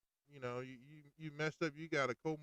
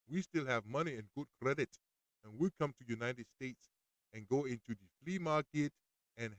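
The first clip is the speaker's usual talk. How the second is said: lightly put-on African accent, a playful vendor pitch; half-hearted African accent, selling cheap goods; a hint of an African street vendor's accent; African accent impression. Mock African vendor persona.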